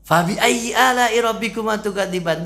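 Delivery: high, loud, brisk verse recital; spoken Quran recitation. Acted. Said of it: "Kita hitung lagi ntar".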